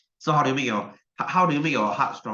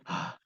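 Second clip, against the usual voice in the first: soft, airy out-of-breath gasp; a tired panting ah; breathless gasp; 11 dB quieter than usual. Shown as a demonstration. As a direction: gasp like you've run out of air. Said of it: "like that".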